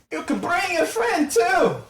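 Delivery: put-on very high, loud old hag screech; shrill hag voice. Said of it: "the hag goes".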